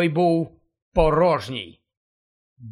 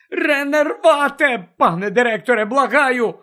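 desperate shouting; 7 semitones higher, 5 dB louder than usual. Acted. Harry begging Dumbledore to wake.

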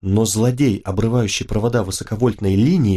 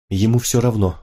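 ordinary delivery, nothing marked

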